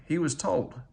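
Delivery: usual speaking voice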